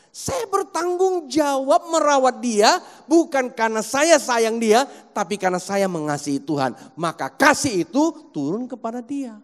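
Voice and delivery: loud, emphatic sermon, voice ringing out; preaching at full force. Acted. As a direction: preach it hard to the congregation. (berkhotbah dengan suara lantang dan penuh semangat)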